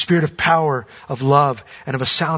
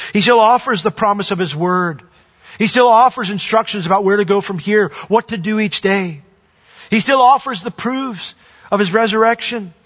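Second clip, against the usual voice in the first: building higher and louder with each he still offers; preaching at full force; 4 semitones higher, 3 dB louder than usual. Acted. Pastor to Easter congregation, preaching at full force. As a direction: lift the congregation toward a peak. Sermon climax, rising repetition.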